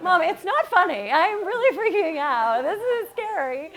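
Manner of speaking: loud, high, laughing protest slowing into fear; half-laughing, half-panicked plea. Retold or imitated. Daughter panicking on the phone to her mom, loud and high.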